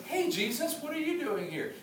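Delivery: high mock wife's voice; mock surprised wife. Pastor mimicking his wife surprised at the door, high pitched. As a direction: squeak it out like a startled wife. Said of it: "Ari opens the door and goes".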